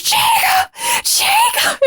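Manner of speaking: high, far-off mock wail of his name; hammed-up Bella wailing; wailing Jacob. Friend imitating Bella's movie wail, mock despairing cry. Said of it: "the part where she's like".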